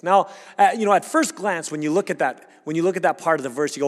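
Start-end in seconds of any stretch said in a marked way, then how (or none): none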